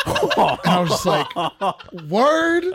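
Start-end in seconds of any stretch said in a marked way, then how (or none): 2.1-2.6 high-pitched mock disbelief; surprised reaction. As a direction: retell it as a raised, punched question. Reacting to clerk's story, retold.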